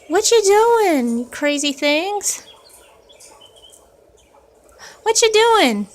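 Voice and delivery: loud, high, delighted; excited high exclaim. Real, not acted. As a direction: react to the birds going wild. Birds go wild in cage.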